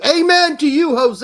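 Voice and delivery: loud, high preacher-like Amen to you. Real, not acted. Hailing a viewer's comment, a loud churchy amen. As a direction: cheer the viewer like a revival shout.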